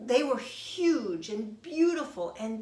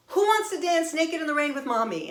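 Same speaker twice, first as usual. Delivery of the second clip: put-on high, loud invitation to the kids; excited calling out; 7 semitones higher, 5 dB louder than usual. Mom inviting daughters to dance in the rain, excited calling out. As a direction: call out eagerly, pitched up and louder.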